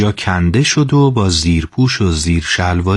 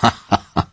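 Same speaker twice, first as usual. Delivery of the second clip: short good-humoured laugh; hearty character laugh; acted chuckle. A narrator's reading. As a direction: laugh as the teacher before speaking. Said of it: "با خنده گفت".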